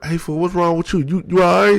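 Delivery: lightly voiced worried friend; concerned friend. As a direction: mimic a buddy asking if he's okay. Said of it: "I don't want nobody like".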